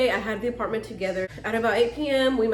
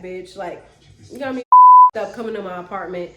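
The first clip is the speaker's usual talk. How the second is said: yelling back; 11 dB louder than usual. Retold or imitated. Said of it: "I started yelling at him back".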